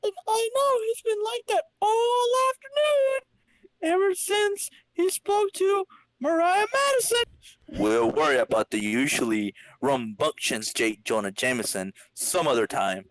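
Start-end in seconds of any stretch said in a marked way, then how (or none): 0.0-7.2 much higher, squeaky telling; falsetto voice. Acted. Narrator gossips about Jameson in a squeaky falsetto.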